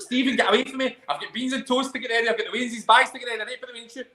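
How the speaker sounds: high, fast mock fluster; lightly voiced exasperated wife; harried wife. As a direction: rattle it off high like a busy wife. S0 acting out busy wife.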